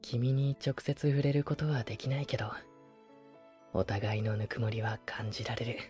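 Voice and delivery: soft tender voice close by; pillow talk. Acted. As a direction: murmur lovingly to soothe to sleep. Sleeping beside listener, tender.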